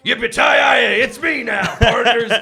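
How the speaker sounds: hammy high cowboy drawl, shouted; a bad, loud cowboy impression. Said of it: "I'm doing a bad cowboy voice".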